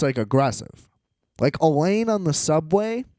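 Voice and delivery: ordinary talk, nothing marked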